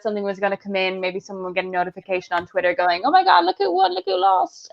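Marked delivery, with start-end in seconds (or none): 3.0-4.7 high-pitched mock news-flash gasping; mock excited. Someone on Twitter reacting to who won, mock excited. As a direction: play someone reacting to breaking news, much higher. Imagined reaction to election notification.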